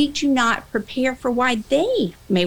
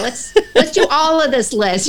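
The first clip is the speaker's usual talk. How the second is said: louder, higher, hammed-up eagerness; mock eager demand; 5 semitones higher, 5 dB louder than usual. Trainer mocking a trainee who wants everything, loud mock eagerness. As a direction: pretend to want the whole list at once.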